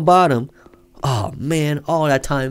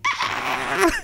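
squealing laugh-shriek; 5 dB quieter than usual. Acted. Recalls taking out trash.

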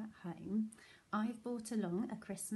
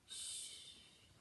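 whisper; 18 dB quieter than usual. A narrator's reading. Hushing listeners.

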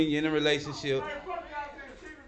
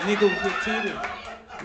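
slow punchline repeat; 5 semitones higher, slower than usual. Retold or imitated.

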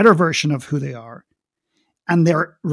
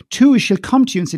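ordinary talk

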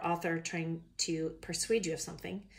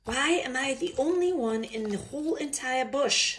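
put-on loud, high, indignant Australian-accented complaint; exasperated outburst; 7 semitones higher, 5 dB louder than usual. Fed-up koala protesting being singled out, exasperated Australian-accented outburst. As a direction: protest at high pitch and full voice.

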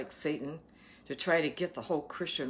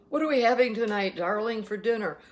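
higher, louder, quick playful spouse voice; mock dinner talk; 6 semitones higher, 6 dB louder than usual. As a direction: ask your darling in a hammed-up lilt. Acts out couple discussing dinner.

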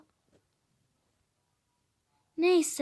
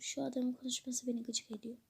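whispered aside; 4 semitones lower, 11 dB quieter, faster than usual. Acted. Grumbling aside about the man.

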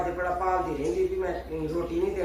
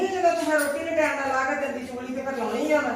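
higher and louder, scolding the bride; angry retort; 6 semitones higher, 4 dB louder than usual. Acted. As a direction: snap back at her, fed up. Mother-in-law quarrel with bride. (ਗੁੱਸੇ ਨਾਲ ਉੱਚੀ ਝਿੜਕ)

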